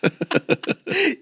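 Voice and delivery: bursting out laughing; high cackling laugh. Real, not acted. Big laugh.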